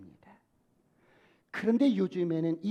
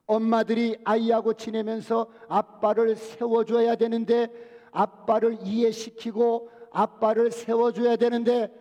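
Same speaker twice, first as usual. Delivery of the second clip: ordinary talk, nothing marked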